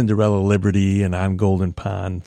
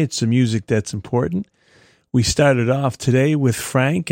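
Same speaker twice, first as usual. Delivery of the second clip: normal talking voice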